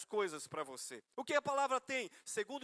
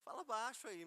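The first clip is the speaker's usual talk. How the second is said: softly retold complaint, well below the heat of the original; toned-down retelling; 3 semitones higher, 7 dB quieter than usual. Husband challenges the man on the phone, toned down for the congregation. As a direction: retell the telling-off in a muted voice. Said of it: "eu não falei nessa tonalidade".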